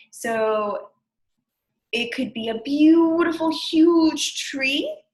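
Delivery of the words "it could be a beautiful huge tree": excited. Acted. Describing a big tree to kids.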